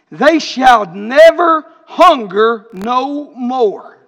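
reading scripture aloud, swelling to a slow shout on the last line. Acted. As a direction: proclaim the verse, then hammer the end. Reads scripture, builds to shout.

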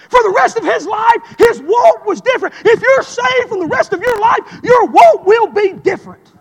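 loud, high preacher's shout; full-force preaching. Acted. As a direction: preach it out to the rafters.

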